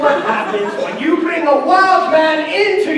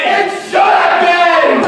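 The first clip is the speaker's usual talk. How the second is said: slow, higher angry shout; 4 semitones higher, 4 dB louder, slower than usual. Acted. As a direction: hurl it back at him. Quarrel at the wedding.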